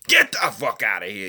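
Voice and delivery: sudden dismissive outburst; Lou DiBella impersonation. Retold or imitated. Acting out a promoter rejecting the proposal, outraged. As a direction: throw it out in mock outrage. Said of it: "that's my impersonation of Lou DiBella".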